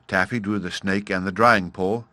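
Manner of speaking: plain reading voice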